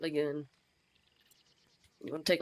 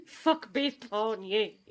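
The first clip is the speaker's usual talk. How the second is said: laughing; 6 semitones higher, much slower than usual. Real, not acted. Still laughing after joke.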